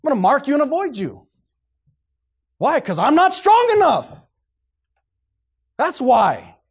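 loud, high, forceful pulpit delivery; full-force preaching. Real, not acted. Preacher refusing company with false teachers, full-force preaching. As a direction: preach it hard, building to a high shout.

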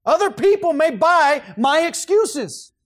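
high, loud, slow preaching cadence, "my" landing hard; full-force preaching. Real, not acted. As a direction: preach it out to the room.

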